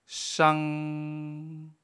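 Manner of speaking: 商 held level and high; first tone. Shown as a demonstration. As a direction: keep 商 flat and high. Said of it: "primer tono, Plano alto". (商 en tono plano alto)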